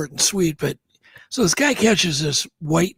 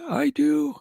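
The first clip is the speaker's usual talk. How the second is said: high "I do"; 10 semitones higher than usual. Shown as a demonstration. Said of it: "First people to say".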